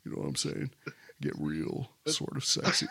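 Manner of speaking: low, soft, mock sexy voice; super deep voice. Shown as a demonstration. Host hamming up his deep voice on the show, soft and low. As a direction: play the smooth deep radio crooner. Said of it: "my super deep voice".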